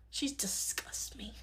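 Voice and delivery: slow, hushed contempt; drawn-out breathy whisper of loathing; disgusted whisper. Acted. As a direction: whisper it slowly, full of disgust. Venting dislike in acted skit.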